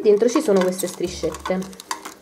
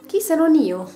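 indignant exclaim; 8 semitones higher, 4 dB louder than usual. Real, not acted. Rhetorical exclamation.

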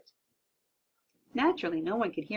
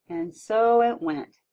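ordinary delivery, nothing marked

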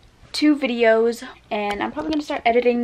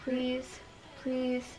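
quiet pleading; 9 dB quieter than usual. Real, not acted. Repeated quiet begging to sister.

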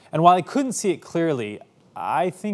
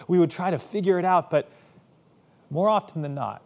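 ordinary talk

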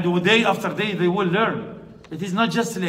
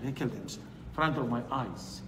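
hushed grief; 7 semitones lower, 13 dB quieter than usual. Retold or imitated. Mother's grief retold.

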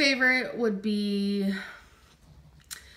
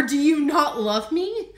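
mock wedding vow; 4 semitones higher, 8 dB louder than usual.

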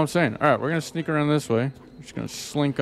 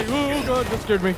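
high, jolted yelp of fright; startled; 9 semitones higher than usual. Real, not acted. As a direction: jump at a sudden scare. Startled by enemy.

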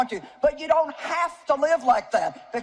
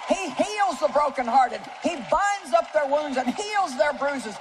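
preaching at full force; 5 semitones higher than usual. Real, not acted. Preacher proclaiming healing to the congregation, fervent. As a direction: lift the room with the promise.

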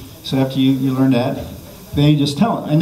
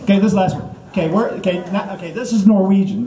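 ordinary delivery

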